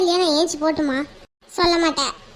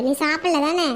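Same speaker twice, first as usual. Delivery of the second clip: ordinary talk, nothing marked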